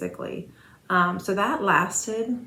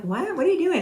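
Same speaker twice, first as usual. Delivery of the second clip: high, startled question, louder at the end; surprised; 10 semitones higher, 4 dB louder than usual. Retold or imitated. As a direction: caught off guard, asking what she's doing. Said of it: "And I'm like".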